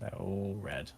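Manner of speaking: very soft, falling echo; hushed repeat. Acted. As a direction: drop to a hush and let "all" fall. Repeats the answer as eerie detail.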